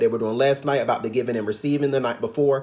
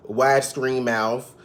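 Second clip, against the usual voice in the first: ordinary delivery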